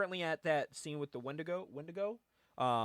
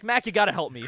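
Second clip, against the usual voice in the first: loud, higher mock pleading; Wolverine; 4 semitones higher, 9 dB louder than usual. Hero begging his friend Mac, loud mock plea. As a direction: plead with a buddy in comic desperation. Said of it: "He's like".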